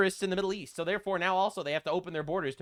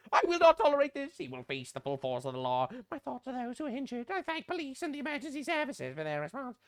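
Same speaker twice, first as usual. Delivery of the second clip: mock politician voice; 5 semitones higher, 4 dB quieter than usual. Mocking Keir Starmer's statement, put-on voice fading soft. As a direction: parody the hollow statement.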